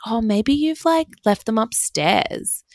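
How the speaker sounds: airy mock-sweet bestie brushing it off; bestie's voice.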